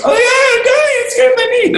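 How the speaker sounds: very high, loud mock child wail; hammed-up child crying over a scraped knee; whiny kid. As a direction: squeal it like a hurt little kid.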